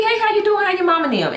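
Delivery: breezy friendly greeting. Retold or imitated. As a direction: greet him like nothing happened, lightly.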